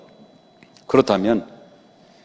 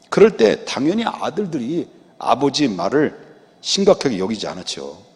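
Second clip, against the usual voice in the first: normal talking voice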